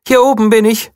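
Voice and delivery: high bunny voice calling out. A narrator's reading. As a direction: shoo them off like a tiny guard. Bunny calls from above.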